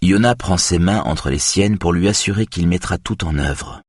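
ordinary delivery, nothing marked

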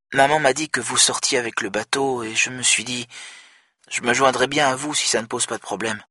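voix plus aiguë et plus douce, hésitante; petite sœur au téléphone. Acted. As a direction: demander timidement à se joindre à eux. Viola voiced on phone. (Viola demande doucement à sa sœur si elle peut venir)

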